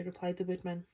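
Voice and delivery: plain reading voice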